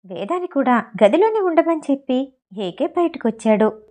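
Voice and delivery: ordinary delivery, nothing marked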